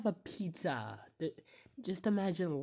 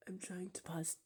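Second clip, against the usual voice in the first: whisper; 10 dB quieter than usual. Real, not acted. Whispering while watching clips.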